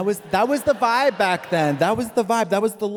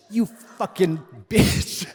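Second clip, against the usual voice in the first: slow, drawn-out put-down; hammed-up, savoured contempt; mock venomous insult; much slower than usual. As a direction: stretch out the insult with relish. Acting out reunion insult.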